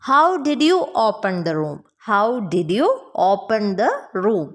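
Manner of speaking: slow, measured question for learners; slow model sentence. Shown as a demonstration. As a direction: say it slowly, phrase by phrase. Teacher models English question slowly.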